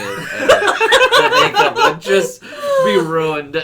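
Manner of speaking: high, loud, slow laughing; laughing hard. Real, not acted. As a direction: squeal the joke out through laughter. Joking about crying in makeup.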